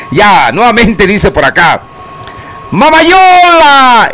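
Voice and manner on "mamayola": long high stretched Mamayola; drawn-out call. Acted. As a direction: stretch the name out loud and high. Lee el mensaje con grito. (llamado largo y agudo)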